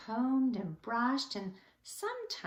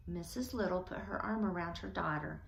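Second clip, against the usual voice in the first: ordinary delivery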